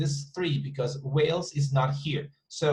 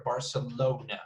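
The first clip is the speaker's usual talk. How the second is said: Barcelona with the stress on LO; Barcelona, third syllable stressed then falling; 4 dB quieter than usual. Shown as a demonstration. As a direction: say bar-ce-LO-na, leaning on the O. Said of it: "the stress is in the O".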